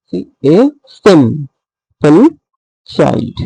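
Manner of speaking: put-on a simple child stretched out very slowly, syllable by syllable; slow scansion demo. Shown as a demonstration. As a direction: drag out a simple child to show the stresses. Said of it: "What is A?".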